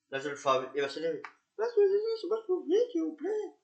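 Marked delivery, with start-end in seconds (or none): 1.6-3.5 much higher, louder put-on voice of a woman begging; pleading drunk woman. Drunk woman begging the officers, high put-on voice. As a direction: beg like the tipsy woman, high-pitched.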